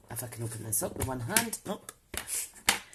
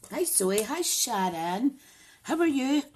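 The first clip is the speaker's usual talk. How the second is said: bright greeting; 9 semitones higher, 4 dB louder than usual. Real, not acted. Greeting viewers by name.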